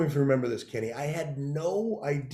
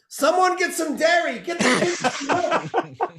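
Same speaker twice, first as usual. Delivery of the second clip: high, loud, laughing shout; Kenny hollering for milk for a friend; classic Kenny shout; 12 semitones higher, 4 dB louder, slower than usual. Retold or imitated. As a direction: bellow it for the waiter to hear. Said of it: "this is a classic Kenny line".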